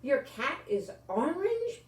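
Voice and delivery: put-on boy excitedly asking if the cat is orange; with excitement. A narrator's reading. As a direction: burst out with eager surprise. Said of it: "Bobby said with excitement".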